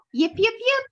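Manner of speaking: high, bright yipping like a little fox; fox calling. A narrator's reading. Reading a picture book to kids, little fox calls out high. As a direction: call out yips up high. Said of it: "She perked up her ears and called".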